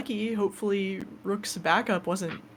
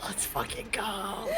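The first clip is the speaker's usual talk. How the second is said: breathless laughing whisper; 9 dB quieter than usual. Real, not acted. Player celebrating the win.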